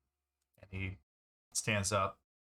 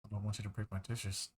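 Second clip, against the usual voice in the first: under his breath; 6 dB quieter, faster than usual. Acted. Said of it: "say something under his breath".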